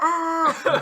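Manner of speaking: loud, high comic scream; big cartoonish yell of pain; Ace Ventura-style scream. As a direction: imitate a shocked shriek, hammed up. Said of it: "It's like Ace Ventura almost".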